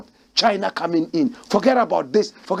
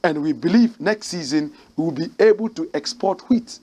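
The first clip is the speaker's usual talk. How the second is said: ordinary talk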